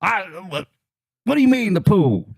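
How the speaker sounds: high, baffled clerk voice; confused Walmart clerk. Retold or imitated. Walmart clerk on speakerphone, high and baffled. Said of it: "And the".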